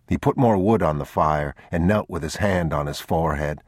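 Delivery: usual speaking voice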